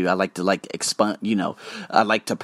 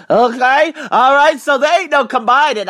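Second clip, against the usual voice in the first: much higher and louder, cocky teasing; mock boast; 13 semitones higher, 7 dB louder than usual. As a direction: play it up like a show-off joking with her. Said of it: "I'm like".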